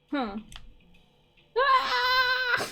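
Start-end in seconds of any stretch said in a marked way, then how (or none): 1.6-2.7 very high, piercing shout of glee; high excited shriek. Acted. Friend greeting a returning old pal, high glad shriek.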